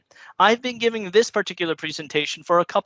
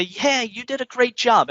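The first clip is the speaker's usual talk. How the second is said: cheerful feedback quote; 6 semitones higher than usual. Retold or imitated. Quoting positive audience feedback.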